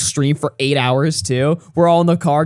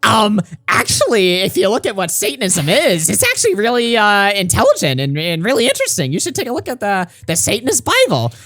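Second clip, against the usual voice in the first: imitating Aiden; 6 semitones higher than usual. Mimicking Aiden defending Satanism on a call, smug high lecturing voice. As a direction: play him as a smug lecturing nerd, pitched up. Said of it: "And Aiden was like".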